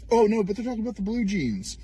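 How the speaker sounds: high sarcastic whine; mocking whine. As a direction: play dumb in a nasal sneer. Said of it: "mocking critics in clip".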